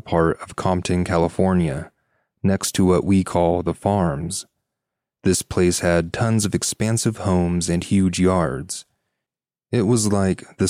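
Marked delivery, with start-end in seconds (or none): none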